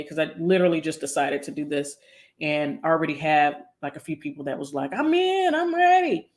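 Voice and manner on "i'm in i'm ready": very high, excited quote of keen joiners; imitating thrilled volunteers way up high; eager mock squeal. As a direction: squeal it like you can't wait to sign up. Quotes eager people signing up.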